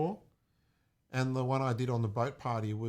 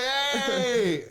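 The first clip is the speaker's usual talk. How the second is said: hype shout; 13 semitones higher, 5 dB louder than usual. Retold or imitated. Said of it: "And I'm like".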